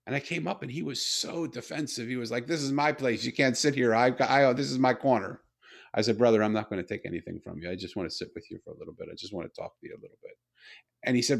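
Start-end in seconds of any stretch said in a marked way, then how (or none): 2.5-5.4 defensive retold. Homeless man defends his spot. 6.2-10.4 reassuring retold. Calming a defensive stranger.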